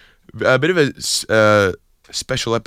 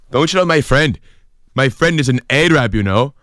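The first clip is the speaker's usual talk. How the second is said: put-on higher-pitched, indignant Irish accent; angry Irishman; 5 semitones higher than usual. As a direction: confront someone as an aggrieved Irish fella. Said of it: "an Irish person is angry".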